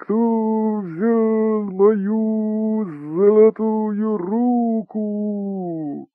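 very slow, high, loud howling ghost voice; wind spirit. Acted. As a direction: moan it like wind carrying a voice. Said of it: "а будто ветер вперемешку с голосом".

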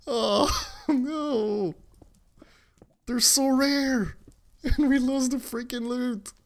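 high, half-laughing wail; laughing lament. Real, not acted. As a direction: mourn the loot while cracking up. Laughing over lost loot.

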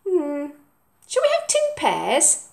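high, lilting question; mum offering. Acted. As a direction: sound hopeful about the pears. Mum's voice.

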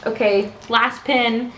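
ordinary talk, nothing marked

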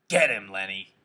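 loud yell through cupped hands. A narrator's reading. George hollers to Lenny across the fight, yelling. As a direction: holler it out at normal pitch, loud. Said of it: "cupped his hands around his mouth and yelled".

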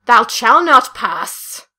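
slow booming wizard command; Gandalf shout. Pretend. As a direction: bellow it like a wizard blocking the bridge. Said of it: "That's from Lord of the Rings".

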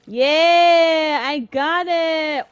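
loud, high triumphant yell; excited cheer. Real, not acted. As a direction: shout the win out. Catching fish in game.

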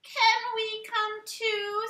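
high, slow chicken voice; chickens asking. A narrator's reading. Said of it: "said the chickens".

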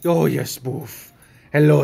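ordinary talk

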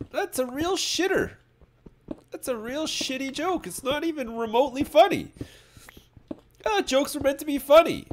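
shrill-free high squeaky rant about a bad joke; high-pitched mock rant. Streamer mocking a donor's joke in a high squeaky rant.

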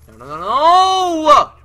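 high, very loud drawn-out wail; anguished yell. Real, not acted. Gamer losing out in the match, anguished yell. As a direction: cry out in real dismay, stretching the last no.

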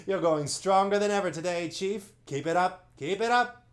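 high, loud cheering; cheering chorus. Acted. Monopods cheering their chief along, loud and high. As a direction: egg the chief on.

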